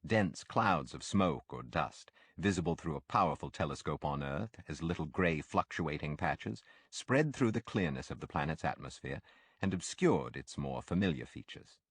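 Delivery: ordinary delivery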